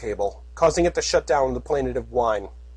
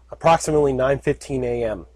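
usual speaking voice

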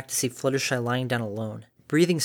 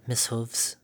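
nervous quiet; 6 dB quieter than usual. A narrator's reading. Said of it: "bit her lip nervously".

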